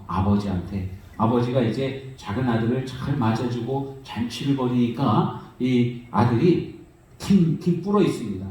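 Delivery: ordinary talk, nothing marked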